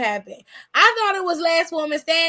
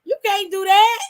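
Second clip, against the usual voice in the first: louder and higher, telling her off; louder scolding; 5 semitones higher, 6 dB louder than usual. Real, not acted. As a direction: call her out sharply. Scolding the imagined churchgoer.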